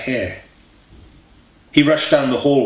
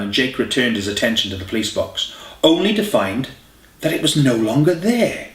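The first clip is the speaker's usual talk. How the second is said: ordinary delivery, nothing marked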